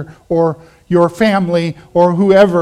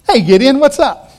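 higher, louder, breezy hello; casual greeting; 5 semitones higher, 5 dB louder than usual. Acted. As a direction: call out a casual hello to someone. The Lord casually greeting hiding Gideon.

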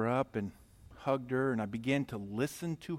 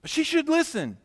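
indignant retort; 7 dB louder than usual. Retold or imitated. Arguing back with God's voice.

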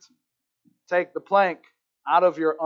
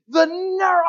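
high, loud acted outrage; steamed; 10 semitones higher, 5 dB louder than usual. Woman about to go off on this guy, steamed. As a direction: play her losing it. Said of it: "She is steamed".

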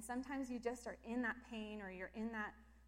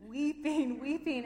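louder, emphatic weeping, weeping; loud emotional weeping; 5 semitones higher, 11 dB louder, slower than usual. Real, not acted. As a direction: lean hard on each word. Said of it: "that ugly cry".

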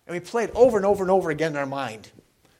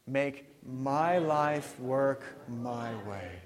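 slow, low, softer, each "my" stressed; very slow recitation; 4 semitones lower, 7 dB quieter, much slower than usual. Shown as a demonstration. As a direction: recite it slowly with the congregation. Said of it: "Could you say that phrase with me?".

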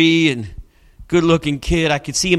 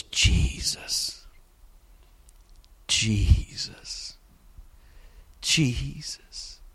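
whispered, breathy prayer; tiny whispered prayer; 5 semitones lower, 10 dB quieter, much slower than usual. Retold or imitated. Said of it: "like a small little tiny whisper".

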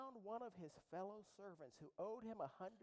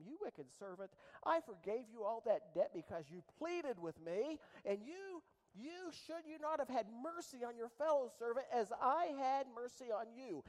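loud, stern king's reproach; stern rebuke; 5 semitones higher, 7 dB louder than usual. Acted. King rebuking his unforgiving servant, stern and loud. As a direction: scold the servant from the throne.

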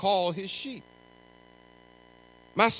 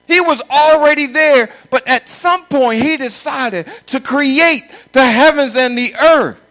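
loud, high fervent preaching; preaching at full force; 5 semitones higher, 14 dB louder than usual. Acted. Pastor preaching loud to the congregation, fervent. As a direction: thunder it from the pulpit.

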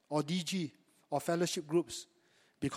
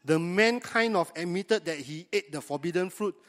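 normal talking voice

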